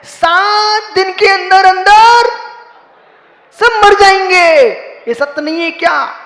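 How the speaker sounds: high, loud impassioned sermon; full-force preaching. Acted. Monk thundering at devotees about death. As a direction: preach it out with rising fervour.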